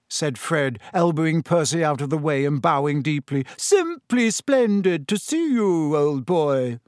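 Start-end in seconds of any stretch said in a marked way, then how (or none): 3.6-6.8 higher, slow, hammed-up upper-class drawl; mocking, overdone old-boy greeting; mock posh toff. A narrator's reading. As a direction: parody a stuffy gentleman. Fred mocks Percy, bowing deeply.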